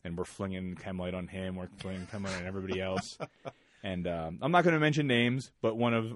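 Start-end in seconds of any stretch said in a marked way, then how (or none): none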